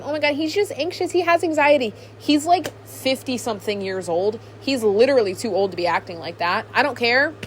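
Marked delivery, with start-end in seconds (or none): 0.0-1.9 higher-pitched imitation of pleading fans; mock defending. Fans defending the actor to critics, mock defending. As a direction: mimic fans making excuses, pitched up.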